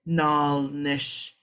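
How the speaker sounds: nal nish said slowly and carefully; Navajo pronunciation. Shown as a demonstration. Teacher modelling the Navajo word for students. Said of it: "and I included those verbs".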